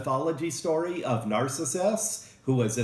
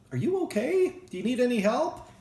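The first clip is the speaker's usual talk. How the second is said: much higher, concerned friend's voice; caring friend; 11 semitones higher, faster than usual. Retold or imitated. Friend checking on a crash victim, concerned and high. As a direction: ask it like a worried friend would. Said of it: "Wouldn't a normal person say".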